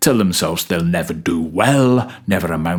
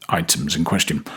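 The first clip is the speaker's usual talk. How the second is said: ordinary delivery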